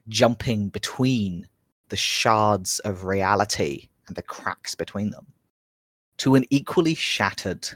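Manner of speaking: slow measured scene setting with pauses; game master narration. A narrator's reading. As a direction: draw them into the ruined temple. GM recaps and sets the scene.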